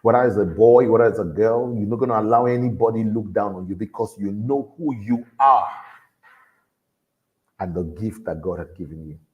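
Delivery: ordinary talk, nothing marked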